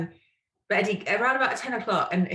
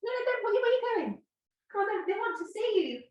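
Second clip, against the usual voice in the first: very high sing-song dog voice; coaxing the dog; 13 semitones higher, faster than usual. Real, not acted. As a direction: coax him sweetly up high.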